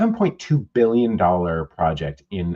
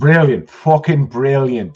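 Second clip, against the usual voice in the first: loud mock-Irish exclamation; Irish "brilliant"; 4 semitones higher, 9 dB louder, slower than usual. Joking with his cohost, loud mock-Irish cheer. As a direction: bellow it like a pub regular from Dublin. Irish banter after accent teasing.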